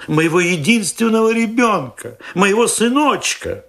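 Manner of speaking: high, pleading cry for his son; desperate cry. A narrator's reading. Father cries high and desperate for his missing son. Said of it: "снова закричал он".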